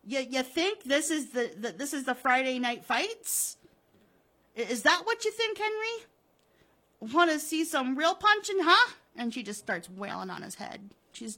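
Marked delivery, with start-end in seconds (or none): 0.1-8.9 high, loud, building rage; enraged, sneering confrontation; furious taunting. Acted. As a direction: challenge him, pitch climbing with each question. Wilma attacks Henry in fury.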